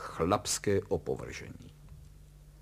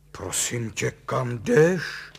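startled, louder question with raised pitch; blurted out; 4 semitones higher, 8 dB louder, much slower than usual. A narrator's reading. Husband seeing his wife dressed to go out, blurting. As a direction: blurt it out loudly. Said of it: "vyhrkl Kalous".

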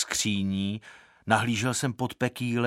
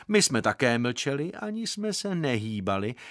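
plain reading voice

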